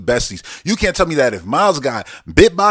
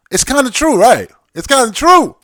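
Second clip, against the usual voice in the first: insistent; 12 semitones higher, 6 dB louder than usual. Real, not acted. Insisting, high and loud.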